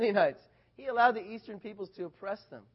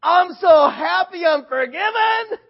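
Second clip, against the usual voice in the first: much higher and louder, slow, bursting with joy; overjoyed shout; 10 semitones higher, 15 dB louder, slower than usual. Retold or imitated. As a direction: shout it out fresh from the water. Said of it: "And he's like".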